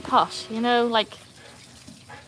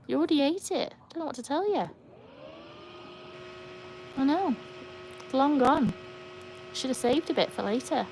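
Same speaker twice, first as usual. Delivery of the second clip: raised, sing-to-the-dog pitch; teasing pet talk; 6 semitones higher, 4 dB quieter than usual. Real, not acted. Telling Hannah dinner is long gone, high pet talk. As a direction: gently scold a pet in a higher voice.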